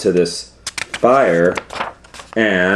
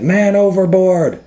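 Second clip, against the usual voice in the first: high, loud sailor's cry; shouting man overboard; 11 semitones higher, 4 dB louder than usual. Acted. Sailors shouting to the crew, loud high cry. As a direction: call it out across the deck. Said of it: "they said".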